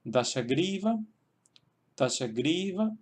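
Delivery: "Dashagriva" with a long, accented GRI; careful demonstration of "Dashagriva", GRI drawn out; stress on third syllable. Shown as a demonstration. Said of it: "se queda ahí el acento".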